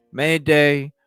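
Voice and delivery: ordinary delivery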